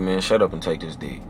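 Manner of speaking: low, soft commanding; seductive command. Retold or imitated. Man to woman in bed, low soft seductive command. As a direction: drop the voice low and order her. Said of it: "Your seductive voice".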